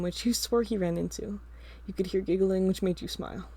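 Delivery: usual speaking voice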